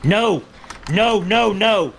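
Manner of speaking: high, loud panicked no; frantic shouting. Acted. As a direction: shout it to stop them. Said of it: "he's like".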